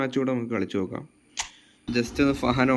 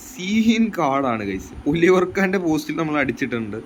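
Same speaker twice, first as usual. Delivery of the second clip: excited; 5 semitones higher than usual. Real, not acted. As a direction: gush about the goal to viewers. Excited after scoring a goal. (ഗോളിന് ശേഷം ആവേശം)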